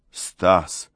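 resigned appeal; a touch of sighing despair. A narrator's reading. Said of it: "вздохнул Гельмут".

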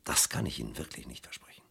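soft, menacing whisper; whispered threat. Acted. Inspector warns the suspect on leaving, whispering. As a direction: breathe the promise as a threat.

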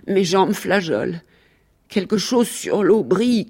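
theatrical monologue, savouring every phrase; dramatic animal storyteller. Acted. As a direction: relive the bath as a grand tragedy.

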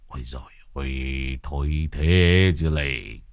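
disgusted groan. Acted. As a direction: groan while holding your nose. Thầy Phọt bịt mũi ghê tởm. (rên ghê tởm)

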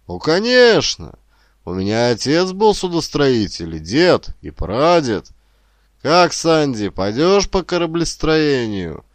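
high, emphatic, sure of the answer; confident grandfather. A narrator's reading. Grandfather urging grandson toward shipbuilding, confidently. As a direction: press the case with lifted, forceful confidence. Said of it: "заранее уверенный в ответе".

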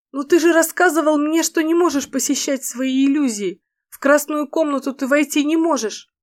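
high, puzzled female voice. Acted. Фэй возражает.

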